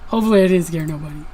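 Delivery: fast, silly put-on voice rattling it off; a quick comic aside in a funny voice; jokey goofy voice. Repeats line jokingly.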